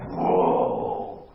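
a low, loud, stunned whoa; awed whoa. Retold or imitated. Teacher acting out a reader's astonishment at the Greek with a loud awed whoa. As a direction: blown away by the word's power. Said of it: "it's like".

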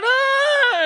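loud high gasp as he's caught; a sudden loud high cry; gasp of relief. Retold or imitated. Peter being pulled from the water, a loud high gasp.

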